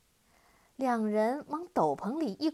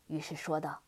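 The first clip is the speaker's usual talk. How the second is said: plain reading voice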